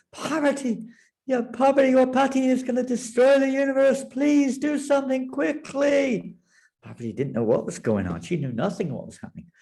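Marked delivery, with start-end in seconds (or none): 0.1-6.4 high, panicked begging; desperate plea. Acted. Frightened demigods begging Parvati, high and desperate. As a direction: beg her to act before it's too late.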